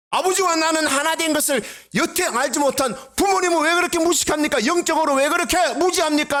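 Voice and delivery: high, sneering put-on voice; mocking scorn. Imagined Jesus sneering at his parents, high mocking voice. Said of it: "라고 비웃었나요".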